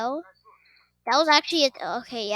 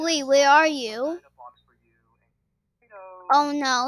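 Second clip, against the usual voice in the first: ordinary delivery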